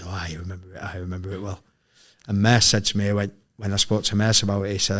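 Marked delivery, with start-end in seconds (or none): 0.0-1.6 much softer, lower quote; hushed imitation. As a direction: drop right down to mimic his reply. Imitating manager recalling the row.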